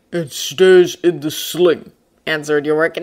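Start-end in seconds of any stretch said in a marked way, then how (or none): 0.1-1.9 slow, firm bear voice; firm bear interrupting. A narrator's reading. Bear overrules the man mid-sentence, firm. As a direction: cut him off with slow finality. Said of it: "not even letting Lee Scoresby finish".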